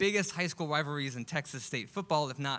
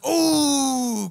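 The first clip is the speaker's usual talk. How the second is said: loud, high rising crowd ooh; a stadium-sized ooh; 7 semitones higher, 10 dB louder than usual. Retold or imitated. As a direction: react like a whole crowd at a big hit. Crowd reacting to the punch.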